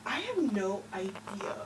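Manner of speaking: hushed, breathy whisper. Acted. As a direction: whisper it slyly. Sly answer.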